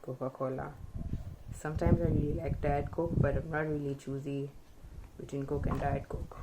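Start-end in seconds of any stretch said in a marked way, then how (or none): none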